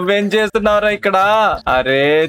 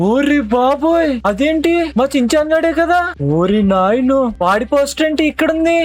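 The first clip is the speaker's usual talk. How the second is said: much higher, amazed shout of recognition; shocked; 6 semitones higher than usual. Acted. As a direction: gasp it out, stunned at the sight. Shock at seeing the poster.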